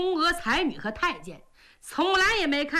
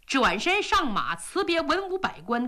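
ordinary delivery, nothing marked

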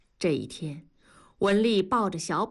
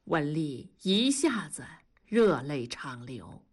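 plain reading voice

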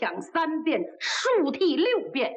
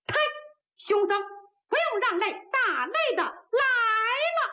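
calling out high; 8 semitones higher than usual. Acted. Challenger in the crowd shouts up to the monk. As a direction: hail the monk from the crowd, slowing on 打累的来. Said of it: "就听下边高喊".

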